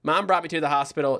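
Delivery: usual speaking voice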